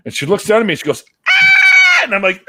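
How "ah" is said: very high, loud imitated shriek; piercing retold scream; woman's scream. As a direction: scream at full pitch like a startled woman. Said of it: "she screamed and ran away".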